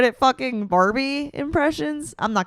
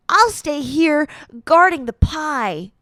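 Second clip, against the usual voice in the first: slow, higher, louder cartoon line; Barbie film character; 5 semitones higher, 5 dB louder, slower than usual. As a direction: draw it out like an animated character. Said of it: "What other Barbie impressions can I".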